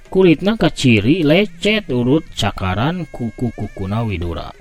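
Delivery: plain reading voice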